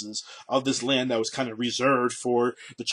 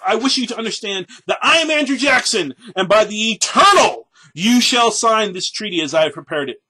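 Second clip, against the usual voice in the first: loud, higher, haughty general's declaration; haughty dignity; 8 semitones higher, 8 dB louder than usual. Retold or imitated. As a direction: puff up with pompous pride. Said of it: "put on all his dignity and thus addressed".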